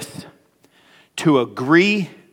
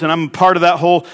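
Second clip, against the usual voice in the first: ordinary delivery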